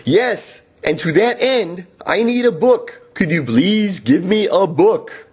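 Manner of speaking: over-patient, spelling every word out; slowed-down insistent plea for a book; strained insistence. Retold or imitated.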